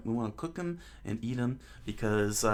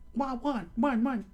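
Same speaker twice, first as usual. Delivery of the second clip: very high falsetto yapping; dog barking; 14 semitones higher than usual. Acted. Dog answers the lion tamer, high-pitched barking. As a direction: yap like a small dog. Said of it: "So said the dog".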